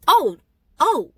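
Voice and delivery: high, loud "oh, oh"; sarcastic oh; mock realization. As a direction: fake dawning surprise.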